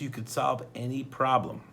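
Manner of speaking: ordinary delivery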